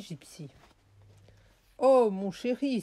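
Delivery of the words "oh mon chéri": higher, louder cry of "oh, my darling"; exclaimed endearment. A narrator's reading. Alexis's mother cries out to her darling, exclaiming. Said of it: "s'écria la mère d'Alexis".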